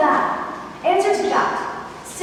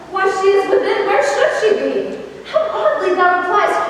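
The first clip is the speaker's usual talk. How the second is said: louder, half-laughing protest; laughing exasperation; 4 semitones higher, 4 dB louder, faster than usual. Acted. Juliet to the teasing Nurse, laughing and louder. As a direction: laugh through the frustration, pressing her.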